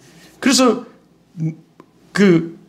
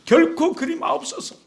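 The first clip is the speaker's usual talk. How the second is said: higher-pitched repeated plea; protesting; 6 semitones higher than usual. Acted. Peter objects to the Lord's suffering, protesting. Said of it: "베드로가 붙들고 항변하여 이르되".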